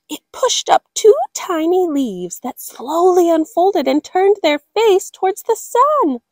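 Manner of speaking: awed wonder. A narrator's reading. Narrator marveling at sprouts, awed excited.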